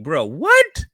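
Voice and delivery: loud, high, rising incredulous question; mock-outraged disbelief, voice jumping up; retold disbelief. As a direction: imitate a friend who can't believe it. Friend's disbelief in retold call.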